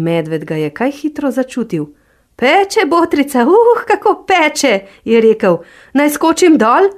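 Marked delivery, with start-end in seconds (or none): 2.4-6.9 bear in pain. Acted. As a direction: wail as the fire burns.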